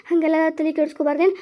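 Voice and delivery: low, soft soothing murmur; calm an upset child quietly; tender and hushed; gentle reassurance. Acted. Mother calming upset child.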